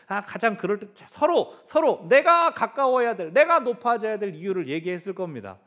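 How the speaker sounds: energetic sermon storytelling; animated. Real, not acted. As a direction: paint the squabble with energy. Preacher's heightened telling.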